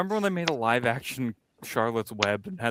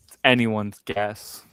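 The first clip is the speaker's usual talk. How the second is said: ordinary delivery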